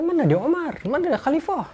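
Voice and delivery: high, puzzled call of a foreign noble; surprised asking. Acted. Hormuzan asking the companions where the caliph is, high and puzzled. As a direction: search the room in disbelief.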